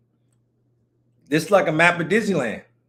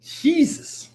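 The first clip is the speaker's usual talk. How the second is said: high gasped Jesus; 12 semitones higher than usual. Real, not acted. Admiring reaction.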